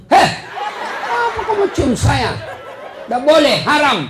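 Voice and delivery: shrill high yelp, then loud firm refusal; shocked refusal. Retold or imitated. Preacher refusing an old lady's kiss, loud shocked yelp. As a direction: recoil from the kiss, loud and outraged.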